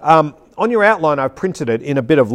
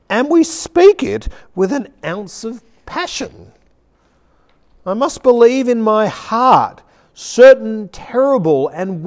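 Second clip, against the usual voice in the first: impassioned sermon reading, higher than usual talk; preaching; 4 semitones higher, 3 dB louder than usual. Shown as a demonstration. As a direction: preach the written words with rising fervour. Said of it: "preach it to you, really, as I read".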